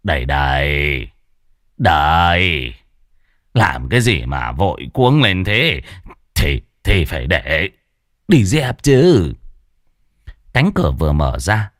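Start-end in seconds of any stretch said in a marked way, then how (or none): 0.0-9.3 low, slow, lightly voiced grumble of an old man; a hint of a grumpy old master woken up; grumbling. A narrator's reading. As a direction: mutter it low as he shuffles to the door. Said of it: "miệng cằn nhằn".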